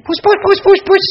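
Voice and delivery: high, loud kitty call. Retold or imitated. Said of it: "And she called out again".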